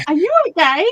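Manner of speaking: a touch of mock alarm, lifted in pitch; exclamation-mark alarm. Friend asking streamer about her morning voice, mildly mock-alarmed. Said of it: "More exclamation".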